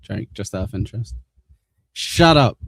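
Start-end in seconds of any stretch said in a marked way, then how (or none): 1.9-2.6 annoyed shout. Real, not acted. Snapping at the noisy aircon.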